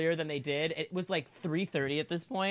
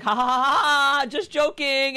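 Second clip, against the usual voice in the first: mocking laugh; 8 semitones higher, 7 dB louder than usual.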